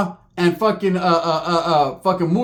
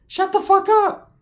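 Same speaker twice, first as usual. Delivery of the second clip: slow, much higher exasperated plea; exasperated hiss-free outburst; 13 semitones higher, slower than usual. Retold or imitated. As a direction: cut her off in disbelief. Telling aunt to stop talking.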